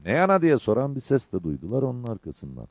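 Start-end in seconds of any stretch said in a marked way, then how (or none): 0.0-0.4 calling a question. A narrator's reading. Arkadan soran takipçinin sesi.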